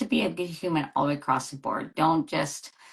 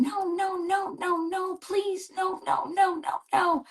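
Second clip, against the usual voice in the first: put-on a little kid pleading not to go in the pool; high, whiny child's no no no; imitating screaming toddlers. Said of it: "they're screaming".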